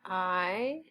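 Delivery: I drawn out as eye, rising at the end; long vowel sound. Shown as a demonstration. Teacher sounding out knife while writing, drawn-out sound. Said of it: "makes the I say I".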